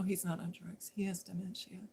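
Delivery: whispered aside. Retold or imitated. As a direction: tell the cop discreetly under your breath. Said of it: "And I go".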